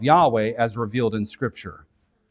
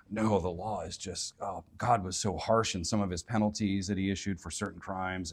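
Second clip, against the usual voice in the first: much softer, sneering mock tone; mocking critics; 6 semitones lower, 8 dB quieter than usual. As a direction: send up the people who scorn the law, quietly. Mocks critics of God's law.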